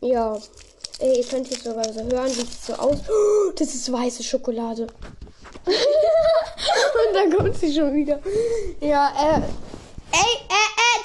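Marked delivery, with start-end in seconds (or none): 5.7-11.0 laughing. Real, not acted. Laughing fit over chocolate.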